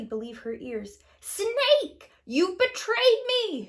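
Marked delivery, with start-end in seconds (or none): 1.2-3.7 very high, loud, slow witch's screech. A narrator's reading. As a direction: screech it like a furious old witch. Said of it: "she screeched".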